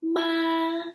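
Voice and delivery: "Ma" held high and level; long high even "ma"; first tone. Shown as a demonstration. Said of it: "four examples and let's practice them together".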